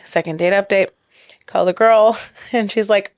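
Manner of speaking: ordinary talk, nothing marked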